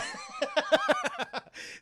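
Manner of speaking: high, drawn-out cackle; theatrical cackle to cap the tale; villainous laugh. Pretend. Cackle after naming Krampus.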